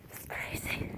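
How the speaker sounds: breathy whispered aside; soft whispered remark. Real, not acted. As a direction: drop to a whisper.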